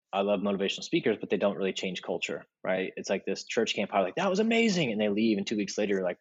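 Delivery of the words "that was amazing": excited. Retold or imitated. Kids leaving church camp hyped.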